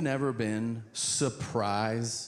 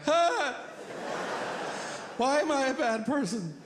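terrified wake-up; 11 semitones higher than usual. Retold or imitated. Comic waking up on the flight, terrified cry. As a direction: jolt awake in fear.

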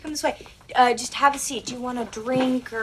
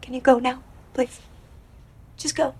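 shaken; 3 semitones higher, 4 dB louder than usual. Real, not acted. Terrified medium after vision.

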